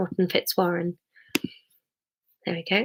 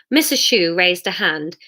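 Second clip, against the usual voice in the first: ordinary delivery, nothing marked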